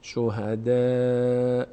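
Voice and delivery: شهداءکم with a thin "de" held long; light dal. Shown as a demonstration. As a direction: slowly stretch the front "æ" in شهداءکم. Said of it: "دال پر خونده نمیشه".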